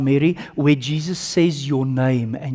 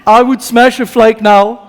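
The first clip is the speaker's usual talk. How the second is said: excited craving; 6 semitones higher, 8 dB louder than usual. Real, not acted. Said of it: "craving chocolate".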